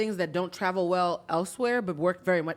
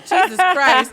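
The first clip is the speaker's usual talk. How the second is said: higher, louder startled outburst; louder, higher, flustered by her tears; startled exclamation; 7 semitones higher, 11 dB louder, much slower than usual. Retold or imitated. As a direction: blurt it out, caught off guard. Startled reaction to weeping stranger.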